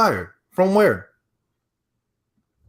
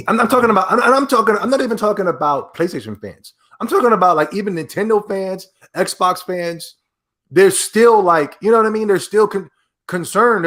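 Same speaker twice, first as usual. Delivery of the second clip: heated rant; 9 semitones higher, 4 dB louder than usual. Real, not acted. Heated rant about exclusives.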